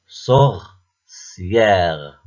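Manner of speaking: "Sorcière" as sor, pause, long cière; stretched "Sorcière", broken in two; split into syllables. Shown as a demonstration. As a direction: show both syllables of "Sorcière". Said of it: "Deuxième syllabe".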